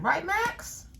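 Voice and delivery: high sing-song question to the dog; pet talk. Real, not acted. To her dog Max, high cooing question.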